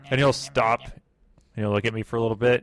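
ordinary talk

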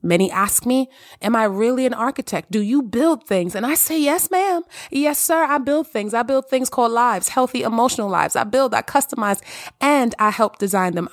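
bright, lifted host voice greeting listeners; podcast host intro. Acted. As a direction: welcome the audience like a radio host. Podcast host opening address.

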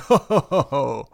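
hammed-up booming ho ho ho; a big villain's laugh, a touch theatrical; mock jolly lord's laughter; deep booming laugh. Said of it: "that deep booming".

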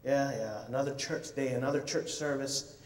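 low, soft, bored sigh of a voice; lightly acted churchgoer boredom; bored and blah. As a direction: drone it like another dull Sunday. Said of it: "feeling kinda blah".

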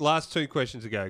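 ordinary talk

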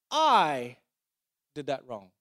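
a big loud "I", pause, then "did that wrong" dropping away; stress on I. Shown as a demonstration. Preacher voicing what shame says, stress on I. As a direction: isolate "I" loudly, then pause. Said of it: "shame says".

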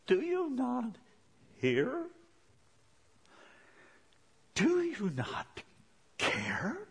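slow, high plea broken by pauses; pleading question. Acted. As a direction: ask God haltingly, pitch leaping up. Voices believer questioning silent God.

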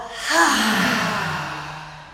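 long audible exhale sigh. Shown as a demonstration. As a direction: let the breath out with a sigh. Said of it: "we're gonna do an audible breath".

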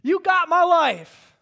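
man recalling prayer, loud slow plea to God; crying out to God. Retold or imitated. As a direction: cry it out to the Lord, surrendering. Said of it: "And I was just crying out".

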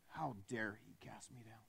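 hissed, bitter whisper as Satan; a fallen angel whispering resentment; whispered devil grumble. Acted. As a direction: seethe it under your breath. Preacher voices Satan's inner grumble.

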